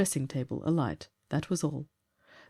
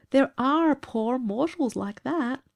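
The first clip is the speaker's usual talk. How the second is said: slow, high character voice; 8 semitones higher, slower than usual. A narrator's reading. Evans speaks in character.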